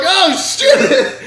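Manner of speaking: high, loud shocked burst; surprised. Retold or imitated. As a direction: blurt it out in shock. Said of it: "I was like".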